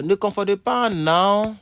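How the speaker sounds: loud slow classroom heading; emphatic announcement. Shown as a demonstration. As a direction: call out the heading like a teacher. Teacher announcing word pair.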